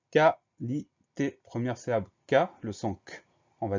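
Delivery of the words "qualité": very slow, louder "qualité" in separate syllables. Shown as a demonstration. Teacher introducing the next spelling word, syllable by syllable. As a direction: dictate "qualité" loudly, pausing between each syllable.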